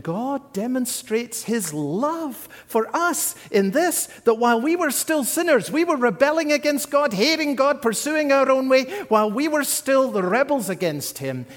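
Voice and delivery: building fervour. Real, not acted. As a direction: build the charge line by line. Preaching builds to a climax.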